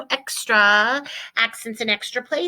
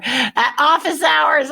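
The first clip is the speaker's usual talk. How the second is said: office hours said slow and higher, correcting herself; corrected phrase; 6 semitones higher, 5 dB louder, slower than usual. Shown as a demonstration. Teacher catching her own slip, slow and higher. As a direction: stress office hours slowly, fixing the slip. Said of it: "I don't mean happy hour".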